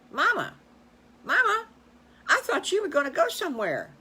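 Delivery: high, loud little piglet voice calling Mama; a little pig who can't wait; excited piglet. Acted. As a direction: piglet squealing for her mother. Piglet calling to her mother.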